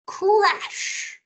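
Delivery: high, drawn-out crash; playful high-pitched crash; big crash shout. Pretend. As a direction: call it out for kids to join in. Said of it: "say crash together with me".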